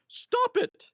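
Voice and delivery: startled high falsetto yelp; high-pitched voice. Retold or imitated. Allah, grabbed by the loins, protests in a mock high-pitched squeal. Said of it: "I say it in a high-pitched voice".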